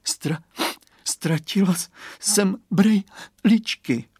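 put-on high, halting sobbing little girl; sobbed. A narrator's reading. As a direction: stammer it out between sobs, slowing. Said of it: "zavzlikala Kateřinka".

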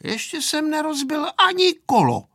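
put-on high, dignified child's retort; proud little comeback; dignified reply. A narrator's reading. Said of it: "odpověděl důstojně Pepíček".

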